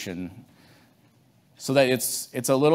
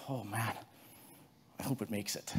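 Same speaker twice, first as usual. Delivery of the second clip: much softer, hoping under his breath; worried murmur; 16 dB quieter than usual. Retold or imitated. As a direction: quietly fret about the crop. Farmer worrying over his crop.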